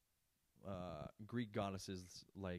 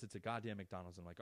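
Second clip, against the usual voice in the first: ordinary talk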